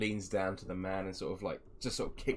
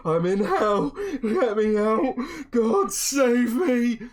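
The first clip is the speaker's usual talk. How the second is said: high, loud wailing panic; freaking-out captive; 13 dB louder, slower than usual. Acted. Man on the floor banging his head, hammed-up hysterical screaming. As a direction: scream for rescue, dragging out the plea. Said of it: "like, freaking out and just banging".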